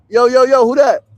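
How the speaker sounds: calling out from inside the tent to a passerby. Real, not acted. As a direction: call out to whoever is there. Someone approaching the tent.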